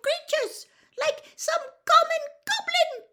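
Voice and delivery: very high, loud indignant squeak; Winky, scolding. A narrator's reading. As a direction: rant shrilly as a scandalised little elf. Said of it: "muffled squeak".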